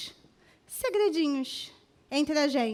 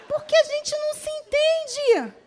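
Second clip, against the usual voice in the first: much higher, laughing as she speaks; 10 semitones higher, 5 dB louder than usual. Real, not acted. Preacher laughs at herself before the congregation, much higher. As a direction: giggle through the admission. Joking about women's moods.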